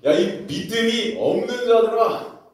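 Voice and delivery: loud, high, slow rebuke; scolding rebuke. Acted. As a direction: scold them with full force. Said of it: "도리어 그들을 야단치십니다". (크고 느리게 꾸짖는 목소리)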